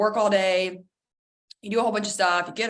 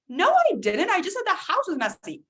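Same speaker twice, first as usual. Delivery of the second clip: higher indignant denial; defensive protest; 9 semitones higher than usual. Retold or imitated. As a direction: insist you did nothing wrong. Argument continues.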